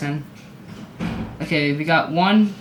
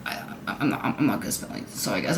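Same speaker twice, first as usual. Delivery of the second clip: very low, half-whispered admission; hushed apology; 8 semitones lower, 10 dB quieter than usual. Real, not acted. Vlogger admitting bad spelling to viewers, hushed. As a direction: say it small and under the breath.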